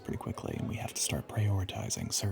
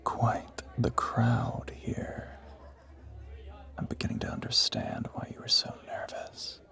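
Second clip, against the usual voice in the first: whisper; 6 dB quieter than usual. Acted. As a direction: murmur it to her privately.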